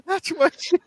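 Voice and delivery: high, helpless laughing speech; cracking up, pitched right up; laughing while talking. Real, not acted. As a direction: squeeze the words out through laughter. Cracking up over his failed accent.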